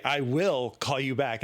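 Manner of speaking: I WILL call you back, WILL drawn out; stress on WILL. Shown as a demonstration. Caller reading the script as written, heavy stress on WILL. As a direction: lean slowly and firmly on WILL. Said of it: "the will is very important".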